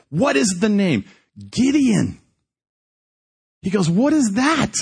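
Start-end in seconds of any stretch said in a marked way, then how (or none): 0.1-2.2 high, emphatic question and answer; urgent exchange. Retold or imitated. Urgent question, triumphant answer acted out. 3.9-4.7 high-pitched astonished question; baffled eagerness. Retold or imitated. Prisoner's eager bafflement acted out.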